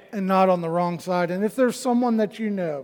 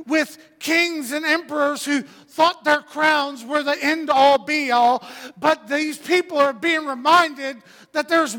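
high, emphatic sermon delivery; fervent preaching; 6 semitones higher than usual. Real, not acted. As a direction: preach it with rising conviction. Preaching to congregation.

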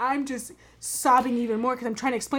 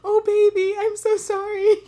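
much higher and louder, gushing like a sorry mom; high mom sympathy; 10 semitones higher, 6 dB louder, slower than usual. Retold or imitated. Mom apologizing to her crying daughter, high and gushing. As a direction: coo it out like a mother comforting her daughter. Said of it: "And she was like".

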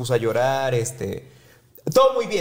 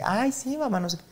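high, sing-song lightly mocked reply; mimicking sister; 10 semitones higher than usual. Sister answering mom at the café, high mocking lilt. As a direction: toss it off like a bored sister.

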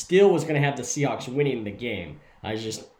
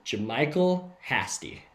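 slow, careful name announcement; repeating for clarity. Real, not acted. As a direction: say the name slowly and distinctly. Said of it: "Say it one more time".

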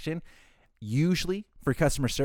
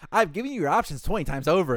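exasperated; 6 semitones higher, 5 dB louder than usual. Retold or imitated. Exasperated agent to guest, retold.

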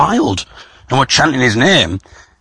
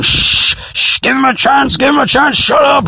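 high-pitched hushing, then urgent yelling; imitated audience shouting; 11 semitones higher than usual. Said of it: "people in the audience shouting".

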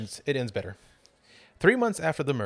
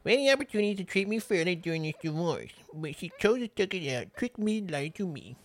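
imagined killer's voice; 9 semitones higher than usual. Acted. Host mocking the killer's self-pitying letter, high character voice. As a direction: read it in a silly high character voice. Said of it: "when you were doing that voice".

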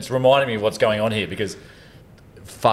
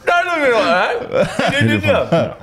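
laughing; slower than usual. Real, not acted. Grilling a friend, laughing.

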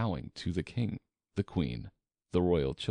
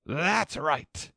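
high royal shout; high, forceful queen's holler; 9 semitones higher, 3 dB louder than usual. A narrator's reading. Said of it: "shouted the queen".

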